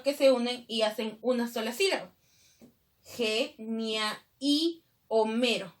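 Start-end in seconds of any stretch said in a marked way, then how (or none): 3.0-5.7 slow, measured syllable breaks. Shown as a demonstration. Teacher splitting a verse into syllables, slow and segmented. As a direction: sound it out slowly in separate chunks.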